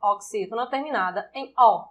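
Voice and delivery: usual speaking voice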